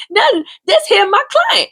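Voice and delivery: high put-on voice brushing it off; a mock innocent denial; imitating the other woman. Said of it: "She was like".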